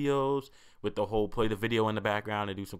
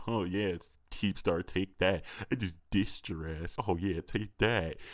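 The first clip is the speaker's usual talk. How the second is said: smug mock voice; 3 semitones lower, 3 dB quieter, slower than usual. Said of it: "facial expression like".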